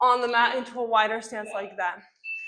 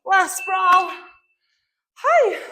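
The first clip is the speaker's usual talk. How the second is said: high loud effort yell; effort shout; 8 semitones higher, 9 dB louder, much slower than usual. Real, not acted. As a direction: push through the last rep. Final sprawl, pushing through.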